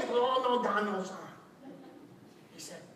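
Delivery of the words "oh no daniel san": Mr. Miyagi. Retold or imitated. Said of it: "it's like Mr. Miyagi".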